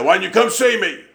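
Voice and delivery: high-pitched imitation of a preacher asking; preacher's mock complaint. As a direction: pitch it up, like a preacher pressing a friend on the phone. Voicing Brother Herbert's phone complaint.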